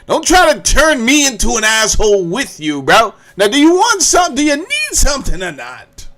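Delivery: loud, rising mock outburst; hammed-up fed-up demand; exasperated retort. Acts out irritated inner retort, laughing.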